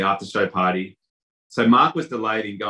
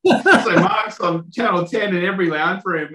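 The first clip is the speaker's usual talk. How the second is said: real laughter breaking into the words, pitched higher; laughing; 10 semitones higher, 3 dB louder than usual. Real, not acted. Telling the limo mix-up to the host, laughing through it. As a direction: laugh through it as the punchline lands. Laughing at own funny story.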